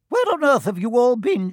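high, loud shocked matron; scandalised Fat Lady. A narrator's reading. Fat Lady to sweaty children, scandalised. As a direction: shriek it in astonishment.

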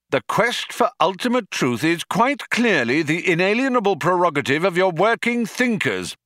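high, emphatic shop-steward complaint; union-man philosopher, indignant. A narrator's reading. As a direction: lay down the union's grievance. Magic Thighs' union harangue.

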